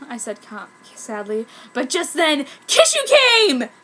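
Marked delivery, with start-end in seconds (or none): 1.7-3.7 high, loud gasping announcement; dramatic entrance. Acted. Narrator announcing Kishu's entrance with excitement. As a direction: blurt out the arrival.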